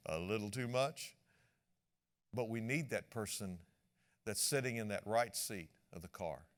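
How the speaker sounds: ordinary talk, nothing marked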